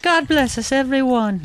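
put-on high sweet child voice; squeaky little boy; Tiny Tim voice.

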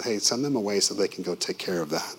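ordinary delivery

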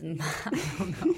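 laughing through the words. Real, not acted. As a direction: giggle as you say it.

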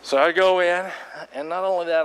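ordinary delivery

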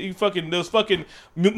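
usual speaking voice

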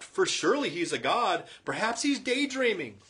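high, loud mocking taunt; scoffing. Elijah mocking the prophets of Baal, high scoffing taunt. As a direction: sneer at the prophets. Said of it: "he scoffed".

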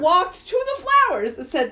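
normal talking voice

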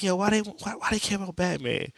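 high cartoonish confusion; squeaky puzzled Barry. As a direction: squeak it out, baffled.

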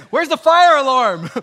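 loud, high hammed-up panic; mock panic. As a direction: shout it like a caught-out sinner in a comic fright. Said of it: "My thought would be".

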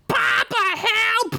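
very high mock whine; mocking child's cry. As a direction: parrot the kid's plea to mock him. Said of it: "Crinkles mocked".

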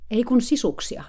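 lightly hammed-up correcting coach; mock coach correcting. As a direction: correct her with impatient authority. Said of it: "suuri valmentaja selvensi".

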